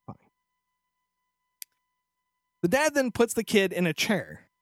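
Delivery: normal talking voice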